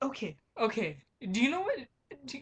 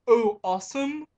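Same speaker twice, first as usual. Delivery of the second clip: louder mocking question on Awesome; mock misreading; 7 dB louder than usual. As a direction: mimic her botched reading. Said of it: "she's like".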